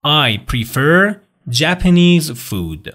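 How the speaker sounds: "I prefer Japanese food" said very slowly, falling on "Japanese"; slow model answer. Shown as a demonstration. Teacher giving students the answer to repeat. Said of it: "حالا شما بیاین بگین".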